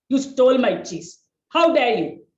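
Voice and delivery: loud indignant scolding; angry scolding. Acted. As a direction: demand an answer, indignant. Jerry scolding Tom.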